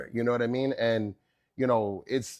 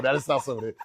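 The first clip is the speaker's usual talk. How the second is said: usual speaking voice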